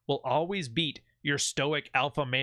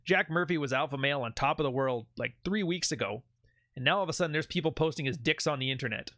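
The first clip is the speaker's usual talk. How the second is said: ordinary talk, nothing marked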